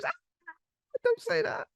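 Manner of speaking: hammed-up wounded protest; wife answering the grim guest in a high mock whine; mock woman's reply. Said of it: "And she goes".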